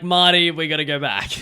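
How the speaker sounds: loud, urgent film-character impression; Doc Brown. Showing friend the impression, urgent loud Doc Brown. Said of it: "my, uh, Doc Brown from, uh, Back to the Future impression".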